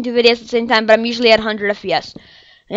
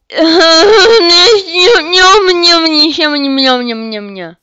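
mock blubbering; 4 semitones higher than usual. Real, not acted. Mock sobbing gibberish.

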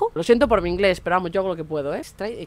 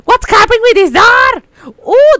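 high, loud alarmed shout; panicked shout; 11 semitones higher, 11 dB louder, slower than usual. Real, not acted. Gamer finds the door open, alarmed loud shout. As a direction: cry out in alarm.

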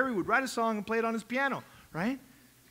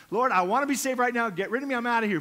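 loud, pleading outburst; mock-urgent cry to be taken away; acted urgent plea; 3 semitones higher, 7 dB louder, faster than usual. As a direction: call out loudly to the Lord. Acting out people wanting rescue.

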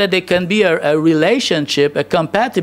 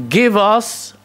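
usual speaking voice

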